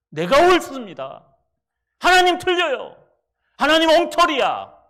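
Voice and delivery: loud, high shout of defiance; defiant shout. Acted. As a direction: rebel against God at full voice. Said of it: "Jonah defying God". (하나님께 대드는 요나, 큰 소리로)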